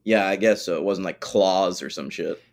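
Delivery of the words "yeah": usual speaking voice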